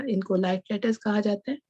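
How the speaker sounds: normal talking voice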